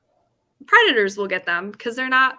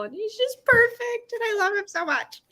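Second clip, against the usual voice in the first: much higher doting voice; cute gushing; 12 semitones higher than usual. Real, not acted. Owner gushing about her rabbit, high doting voice.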